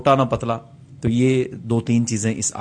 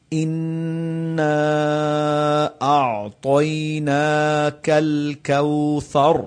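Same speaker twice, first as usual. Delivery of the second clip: slow chanted اِنَّا أَعْطَيْنَاكَ الْكَوْثَرَ; tajweed recitation; much slower than usual. Shown as a demonstration. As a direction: recite like Quran, drawn out. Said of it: "اب آگے آ گیا لفظ کوثر".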